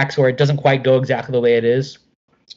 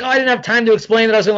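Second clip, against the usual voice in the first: quick breezy excuse; 8 semitones higher, faster than usual. Retold or imitated. Mark explaining breezily.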